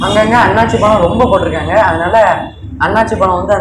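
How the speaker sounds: speaking past fist on chin; hand at mouth. Real, not acted. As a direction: lips partly pressed by hand. Seen 0: Fist rests at chin and lips during early words; no chewing seen.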